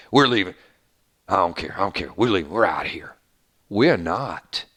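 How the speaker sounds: lazy menacing drawl, voiced low; Alpha's drawl. Host imitating Alpha leaving Lydia, low drawl. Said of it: "She got that drawl".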